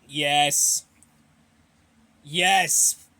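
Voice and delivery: loud, eager affirmation; emphatic yes. Real, not acted. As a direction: belt out an enthusiastic yes. Eagerly answers question about visiting.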